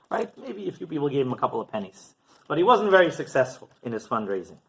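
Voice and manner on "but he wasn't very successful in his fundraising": usual speaking voice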